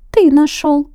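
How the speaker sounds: high squeaky shout; loud mouse shout. A narrator's reading. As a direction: shout the answer out. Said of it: "громко закричали мышата".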